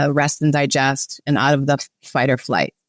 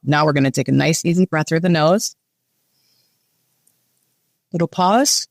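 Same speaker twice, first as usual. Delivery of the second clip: guided breathing. Shown as a demonstration. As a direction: guide the breath gently, with long pauses.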